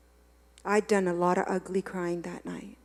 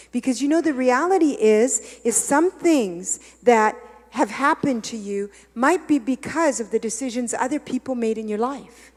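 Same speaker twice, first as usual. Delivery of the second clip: louder, higher, driving sermon delivery; fervent preaching; 5 semitones higher, 9 dB louder than usual. Acted. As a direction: preach it hard to the congregation. Preaching at full force.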